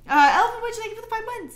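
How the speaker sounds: bright, loud, high call to a subscriber; excited shout-out. Real, not acted. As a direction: greet the viewer with a burst of energy. Reading out a sub alert excitedly.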